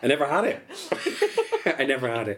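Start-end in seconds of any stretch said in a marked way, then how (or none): 0.6-1.7 squealing high laugh. Real, not acted. Laughing at herself.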